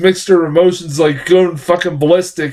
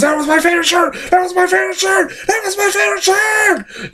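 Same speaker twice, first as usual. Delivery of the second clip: much higher, building to a shrieking shout; unhinged screech, climbing with each repeat; Nicolas Cage shouting; faster than usual. Retold or imitated. Cage screaming at the biker over his slashed shirt, escalating shriek. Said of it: "He goes".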